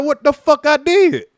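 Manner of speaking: loud, high, disbelieving protest; man on the ground shouting his protest; retold outraged yell. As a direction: yell it, baffled and pinned down. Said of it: "And I'm like".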